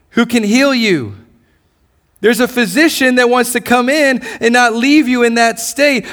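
normal talking voice

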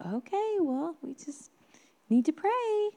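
high, sing-song parent voice; parent to small child. Retold or imitated. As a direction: gently put off a child in a high voice. Parent answering small child's plea.

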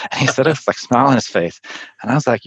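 normal talking voice

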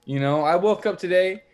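worked-up, high-pitched comic tirade; pissed off. Acted. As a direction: rant with wounded pride, punching key words. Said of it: "I was pissed".

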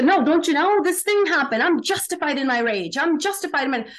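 mocking the self-righteous angry voice, pitched high; indignant inner voice. Speaker mocks the angry mind insisting it is justified, high and indignant. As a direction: whine out the justification like an offended ego. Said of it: "We are ju".

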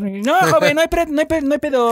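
high, hammed-up vendor voice; street vendor voice. Vendor answering podcast question.

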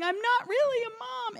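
very high, squeaky plea on the verge of tears; tearful puddle. Retold or imitated. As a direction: act out falling apart in a high wail. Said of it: "just became like a puddle".

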